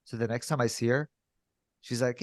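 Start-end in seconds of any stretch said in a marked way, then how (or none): none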